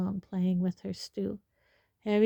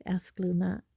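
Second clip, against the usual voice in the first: usual speaking voice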